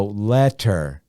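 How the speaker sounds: "letter" slowly split as let-ter; teacher breaking "letter" into let and ter; syllable by syllable. Shown as a demonstration. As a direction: sound out "letter" in two distinct beats, let, ter. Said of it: "two syllables. Let, one syllable".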